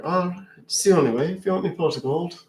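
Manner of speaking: coaxing Irish brogue; leprechaun. Acted. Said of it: "I'm a leprechaun".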